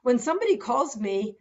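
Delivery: normal talking voice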